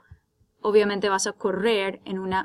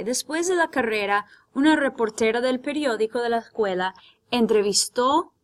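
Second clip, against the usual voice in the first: ordinary talk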